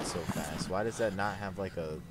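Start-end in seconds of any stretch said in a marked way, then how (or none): none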